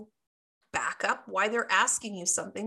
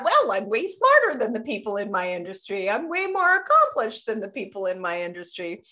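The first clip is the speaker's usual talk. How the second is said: mock boasting; 7 dB louder, faster than usual. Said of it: "good luck saying that".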